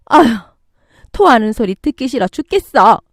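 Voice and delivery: irritated outburst with loud peaks; angry mother-in-law. Acted. Mother-in-law scolding the retching daughter-in-law, angry. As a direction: snap in exasperation at the noise. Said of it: "화를 내기 시작했어요".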